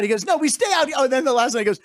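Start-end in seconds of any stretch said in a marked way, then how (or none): 0.2-0.9 mock indignant, high-pitched retort; high, huffy comic outburst; retold flip-out. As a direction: imitate an offended singer losing his temper. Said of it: "And he flipped out".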